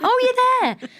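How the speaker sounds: high, loud happy squeal; thrilled she's on shift; overjoyed burst of relief; delighted relief. Retold or imitated. Relieved delight on phone call.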